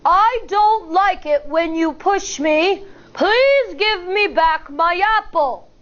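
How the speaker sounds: small creature finding a firm voice; firm big voice. Acted. Shy firefly practicing his big voice with teacher. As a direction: stand tall and say it firmly. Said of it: "Then he firmly says".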